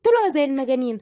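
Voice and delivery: ordinary delivery, nothing marked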